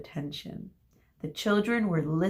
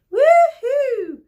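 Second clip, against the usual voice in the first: shrill high woo-hoo; triumphant cheer; 13 dB louder than usual. A narrator's reading. Group B cheering their trip win, a high whoop. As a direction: whoop like winning kids. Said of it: "Group B again cheered triumphantly".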